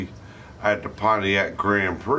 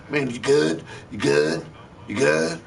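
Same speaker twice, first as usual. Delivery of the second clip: higher, insistent hustler voice; pushy dope fiend; 6 semitones higher than usual. Retold or imitated. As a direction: pester like a fiend after a handout. Dope fiend hustling in parking lot.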